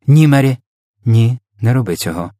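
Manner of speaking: queen's abrupt, forceful no; sharp command. A narrator's reading. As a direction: cut in sharply to stop the maid. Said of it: "Королева так гостро це сказала".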